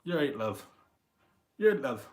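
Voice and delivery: breezy greeting with a lift; casual British greeting. Shown as a demonstration. Streamer showing friends' everyday greetings, breezy and casual. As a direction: toss off a friendly British hello. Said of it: "my go-to greeting is".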